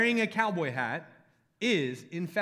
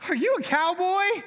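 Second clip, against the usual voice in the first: put-on much higher, eager kid voice; young boy asking; 12 semitones higher, 4 dB louder than usual. Little boy asking the pastor in his hat, high and eager. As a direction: play the wide-eyed little boy. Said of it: "one boy is like".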